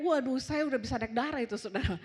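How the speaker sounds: ordinary talk, nothing marked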